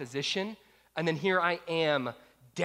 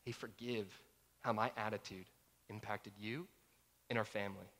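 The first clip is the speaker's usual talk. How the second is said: sincere apology; 7 semitones lower, 11 dB quieter than usual. Retold or imitated. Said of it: "I'm sharing".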